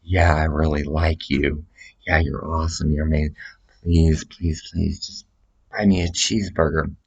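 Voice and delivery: softly voiced sweet-talker; wheedling guy. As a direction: coax him for a cheeseburger. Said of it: "then he comes to me".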